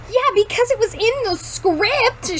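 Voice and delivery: high, slow, emphatic retort; mild yell. A narrator's reading. Girl defending the kiss to her co-star, mock yelling. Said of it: "she yells".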